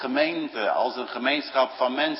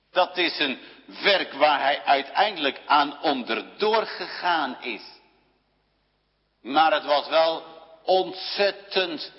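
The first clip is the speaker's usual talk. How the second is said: raised, higher preaching voice; 4 semitones higher than usual. Acted. Preacher to congregation, preaching at full voice. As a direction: proclaim it to the whole church.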